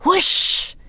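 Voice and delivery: high, breathy wind sound, no voice; whispered wind noise; whispered whoosh. A narrator's reading. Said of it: "Annie whispered back".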